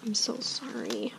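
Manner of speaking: whispered sorry. Real, not acted. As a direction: whisper it close to him, slowly. Comforting her injured son.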